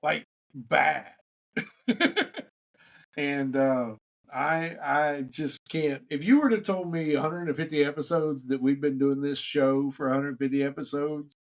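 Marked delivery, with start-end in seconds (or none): none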